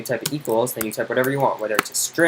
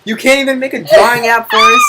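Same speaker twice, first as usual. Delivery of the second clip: high, very loud outburst; exasperated yell; 11 semitones higher, 11 dB louder than usual. Real, not acted. Tutor berating student over drawing app, exasperated high yell. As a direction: yell it at the end of your patience.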